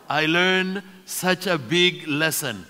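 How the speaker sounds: ordinary talk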